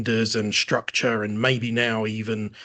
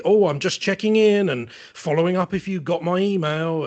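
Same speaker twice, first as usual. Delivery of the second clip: higher, louder sing-song passive aggressive; mock nudge email; 7 semitones higher, 5 dB louder than usual. Mocking salespeople who chase leads, a sing-song mock nudge. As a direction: parody a pushy follow-up. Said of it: "trying to sort of be gentle or passive aggressive".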